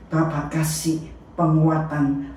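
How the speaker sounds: ordinary delivery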